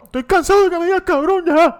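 high, laughing whine of a fed-up friend; he imitates his friend quitting, in a laughing mock whine; mocking imitation. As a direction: squeak it through laughter like an offended kid. Imitating the friend's final complaint.

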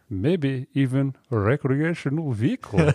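put-on slow, light Russian accent. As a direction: drawl it out in a mock Russian accent. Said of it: "I gotta get my Russian accent right".

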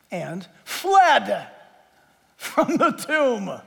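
drawn-out, deliberate reading of the verse; lingering dramatic Bible reading; slow scripture reading. Acted. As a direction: savour each word of the text slowly.

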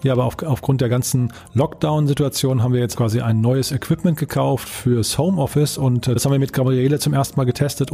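normal talking voice

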